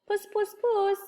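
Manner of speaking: high-pitched cat call. A narrator's reading. Old man hunting for a missing cat, calling high. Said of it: "he called".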